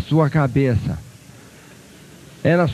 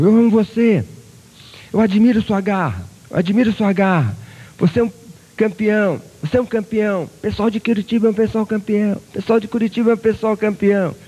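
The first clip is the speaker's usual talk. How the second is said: loud, high ringing calls; echo shouting back; 8 semitones higher, 3 dB louder than usual. Acted. The echo praising the boy and Curitiba, loud ringing call. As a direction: boom it back like a mountain echo. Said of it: "Aí o eco repetiu".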